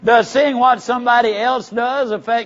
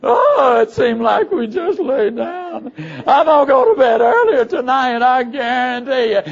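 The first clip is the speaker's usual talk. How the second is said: groggy sleeper; 3 semitones higher, 3 dB louder than usual. Retold or imitated. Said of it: "slap their face and said".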